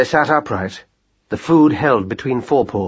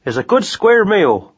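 higher rat-catcher voice, relaxed; Jim's voice; 7 semitones higher than usual. A narrator's reading. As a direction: promise breakfast cheerfully. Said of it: "he said".

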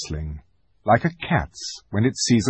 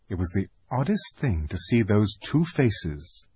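plain reading voice

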